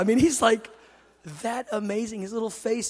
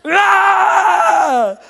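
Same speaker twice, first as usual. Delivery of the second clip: long, loud, high "aah"; mock toddler battle cry; imitated frenzied yell; 10 semitones higher, 12 dB louder than usual. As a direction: holler wildly like a rampaging little boy. Acts out son banging brother.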